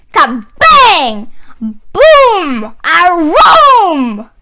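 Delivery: loud booming crashes; growling thunder voice. A narrator's reading. Narrator voicing the thunder growling, loud and booming. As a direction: bellow like thunder.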